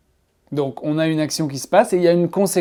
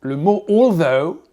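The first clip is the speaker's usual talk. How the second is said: ordinary talk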